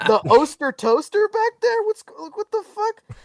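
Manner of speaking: high, disbelieving questions; mock incredulous rant. Diner questioning the so-called chefs, high comic disbelief.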